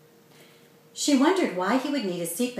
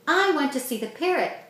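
put-on insistent, somewhat higher child's demand; 6 semitones higher than usual. A narrator's reading. Kevin insisting to his sister, demanding. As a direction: push for it like a stubborn kid. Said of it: "Kevin demanded".

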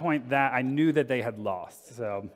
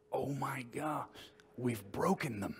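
whispered mock horror; 8 dB quieter than usual. Mock whisper to friends after breaking the company. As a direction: hush it like a guilty secret. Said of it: "And we're like".

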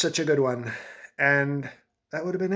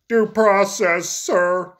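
loud, high, drawn-out blubbering imitation; mock crying; 5 semitones higher, 7 dB louder, much slower than usual. Brett Kavanaugh pleading with the president, mock crying. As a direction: wail it slowly, hammed-up and tearful. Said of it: "Stop crying, Brett".

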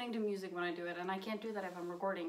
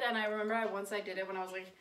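ordinary talk, nothing marked

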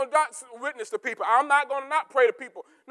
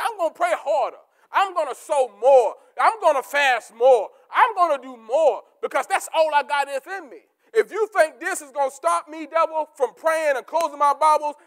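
a defiant vow to the devil, building; 5 dB louder than usual. Acted. Vow of determination.

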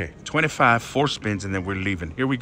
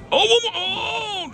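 excited shriek. Real, not acted. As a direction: shriek at the reels. Bonus near miss.

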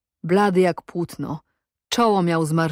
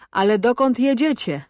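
higher, mocking interrogation; jeering question; 5 semitones higher, 3 dB louder than usual. A narrator's reading. Cossack quizzes captive on where he goes, mocking. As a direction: ask it with a raised, teasing lilt. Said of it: "zażartował".

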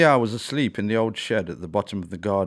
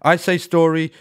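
loud, higher call to start the chant; said loudly; 8 semitones higher, 9 dB louder than usual. A narrator's reading. The owl leading the story chant loudly for Ella. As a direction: call out the first half, big and bright. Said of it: "said loudly".

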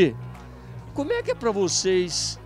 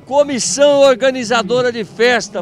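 loud call-out; 3 semitones higher, 7 dB louder than usual. Real, not acted. Host calls out to organizers.

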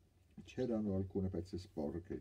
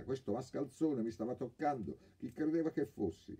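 higher, louder angry burst; exasperated outburst; 9 semitones higher, 4 dB louder than usual. Acted. Girl bursts out against the groping thief, exasperated. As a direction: explode at him, exasperated. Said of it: "esplose, esasperata".

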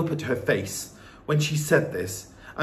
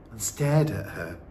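plain reading voice

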